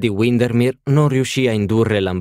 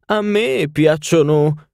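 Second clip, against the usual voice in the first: put-on much higher, louder grand old lady; haughty duchess; 8 semitones higher, 5 dB louder, slower than usual. A narrator's reading. Duchess telling the party of her castles, lofty and high.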